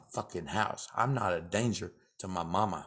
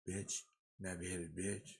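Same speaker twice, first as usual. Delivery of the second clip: muttered insult; 3 semitones lower, 14 dB quieter than usual. Real, not acted.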